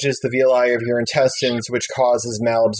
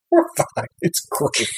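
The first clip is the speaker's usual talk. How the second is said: whispered mock we're fine; breathy fake everything-is-great; mock reassurance; slower than usual. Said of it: "It's like".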